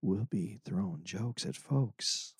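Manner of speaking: low, hushed late-night jazz DJ; jazz radio voice. Pretend. Host plugging tour dates to listeners, jazz radio voice. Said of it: "I can do a jazz radio voice".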